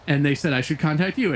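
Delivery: ordinary talk, nothing marked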